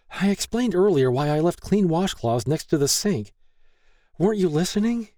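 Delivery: high-pitched, defensive reply; wary retort. A narrator's reading. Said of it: "Lay warily retorted".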